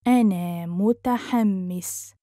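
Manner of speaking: measured, careful teaching pace. Shown as a demonstration. Teacher repeats phrase slowly joined.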